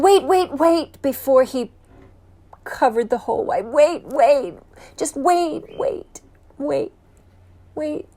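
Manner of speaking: high, loud, desperate begging; frantic pleading. Acted. Prisoner begs Montresor before the last brick, frantic. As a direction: beg him to stop before the hole closes.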